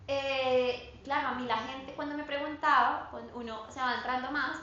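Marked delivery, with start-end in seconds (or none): none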